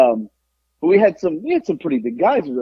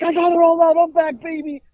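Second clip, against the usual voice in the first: high-pitched, hammed-up comeback cry; triumphant boast; 13 semitones higher than usual. Drunk man carries on to other bars, acted triumphant boast. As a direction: crow about it, voice pitched way up.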